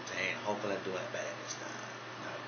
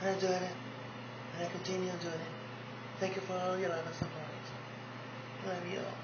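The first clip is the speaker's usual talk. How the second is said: lightly higher, soft pageant-queen voice; Mercedes impression; 8 semitones higher, 5 dB quieter than usual. Pageant queen Mercedes thanking fans, lightly higher and soft. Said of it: "we got Mercedes Ellis Del Rio".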